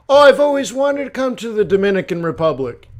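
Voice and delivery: high, loud mock enthusiasm; gushing mistaken tourist. Imitating a tourist in Dominica naming the wrong country, mock gushing. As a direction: gush it like a clueless visitor. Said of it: "go to Dominica and say".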